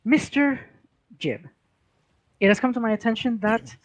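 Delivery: a fussy official laying out a charge; officious clerk. Acted. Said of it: "and she goes".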